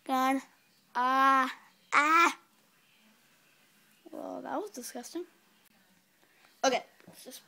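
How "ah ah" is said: disgusted yell. Real, not acted. After disgusting bean.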